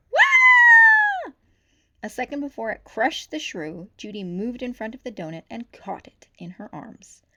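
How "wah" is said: very high, loud shriek; terrified scream. A narrator's reading. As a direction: shriek as the donut comes at you. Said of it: "screamed in terror".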